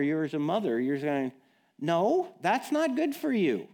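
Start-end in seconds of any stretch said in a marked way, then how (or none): 1.8-3.7 exasperated parent. Retold or imitated. Parent arguing with child.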